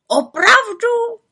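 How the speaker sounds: high, slow amazed raven cry; surprised exclaim. Acted. Raven Abraxas to the little witch, amazed. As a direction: drag out the amazement. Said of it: "zvolal Abraxas".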